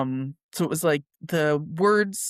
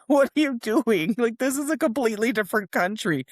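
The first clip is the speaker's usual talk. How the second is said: higher-pitched, exasperated protest; mock exasperation; 6 semitones higher than usual. Islander reacting to tourists' fake accent, mock exasperation. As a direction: act out a baffled local's objection.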